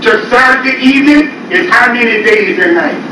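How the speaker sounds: ordinary delivery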